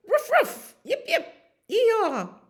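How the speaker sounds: very high, squeaky barking into a whoop; high, gleeful barks and yee-haw; excited dog barks. Acted. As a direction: yap like an eager herding dog. Dog sets on supposed cow.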